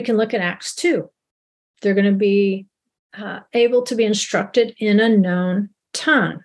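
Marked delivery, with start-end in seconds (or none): none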